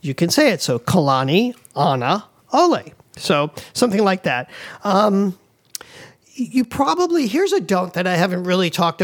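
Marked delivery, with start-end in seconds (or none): 0.6-2.9 Kalani, Ana, Ole said as separate chunks with pauses between; broken into parts. Shown as a demonstration. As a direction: chop Kalani, Ana, Ole into pieces, last part lifted. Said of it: "break it into smaller parts".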